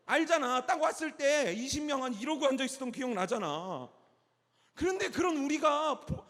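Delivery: high, loud, incredulous recounting; loud emphatic preaching. Real, not acted. Preacher reminding young people how few they were, loud. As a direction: push it out high and punchy to the room.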